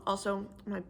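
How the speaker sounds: usual speaking voice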